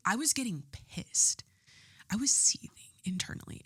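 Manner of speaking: whispered, a lid held on anger; seething whisper. Retold or imitated. Telling viewers how the halftime switch made her fume, whispered. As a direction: hiss it out under your breath.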